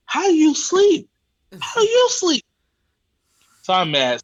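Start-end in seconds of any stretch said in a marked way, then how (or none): 0.1-1.1 very high, loud incredulous shout; incredulous yell. Retold or imitated. Yelling at the sleepy clerk. 1.6-2.4 even higher, louder outraged repeat; incredulous shriek. Retold or imitated.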